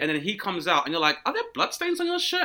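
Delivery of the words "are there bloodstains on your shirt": mock alarmed question. Alarmed passenger questioning pilot.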